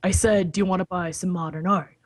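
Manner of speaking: ordinary talk, nothing marked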